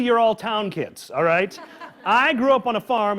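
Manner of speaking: usual speaking voice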